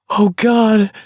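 high, strained gasp. A narrator's reading. Walker helped to his feet, gasping from helpless laughter. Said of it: "Garrity gasped".

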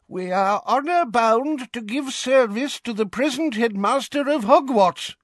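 put-on high, quavering old man; frail old wizard. A narrator's reading. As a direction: cry out like a frail elder. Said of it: "cried a frail-looking old wizard".

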